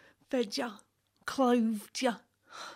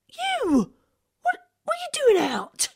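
much higher startled cry; shocked shriek; 8 dB louder than usual. Acted. Wife startled by boy.

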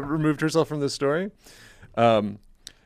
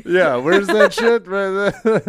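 laughing; 7 semitones higher, 5 dB louder, slower than usual. Real, not acted. Amused at the absurd story.

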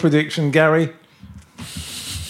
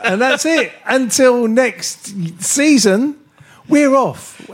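higher, bright farewell with a laugh; host signing off the season, cheerfully higher; cheery sign-off; 7 semitones higher, slower than usual. Real, not acted. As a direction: sign off the show with a grin.

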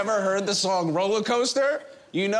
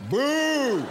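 booing; 6 semitones higher than usual. Acted. Heckler booing the host.

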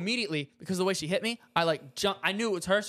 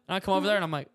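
usual speaking voice

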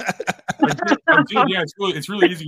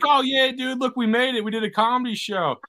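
higher-pitched gushing imitation; put-on happy cheer, pitched up; mock excitement; 8 semitones higher, slower than usual. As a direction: imitate a thrilled buddy celebrating. Mimicking everyone else's happy excitement.

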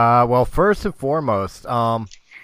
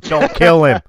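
laughing plea; 4 semitones higher, 11 dB louder than usual. Real, not acted.

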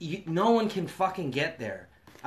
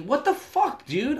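mock indignant outburst; retold pissed off; 9 semitones higher than usual. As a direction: recount being pissed, pitch jumping up. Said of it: "I'm, like, pissed. Now I'm mad".

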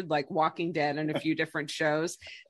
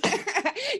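amused, higher-pitched laugh; 14 semitones higher than usual. Real, not acted. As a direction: laugh at the co-host's excuse. Amused by co-host's remark.

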